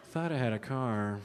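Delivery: soft, puzzled stoner musing; quietly doubt your own memory; bewildered pothead. Retold or imitated. Comic as pothead hunting a missing car, bewildered.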